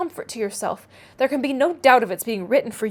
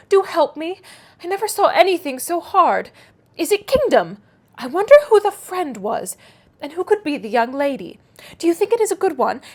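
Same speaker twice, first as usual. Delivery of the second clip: put-on higher, breathless girl puzzling over a riddle; excited naive girl begging for help; eager young Harriet; 5 semitones higher, 3 dB louder than usual.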